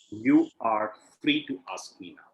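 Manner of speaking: ordinary delivery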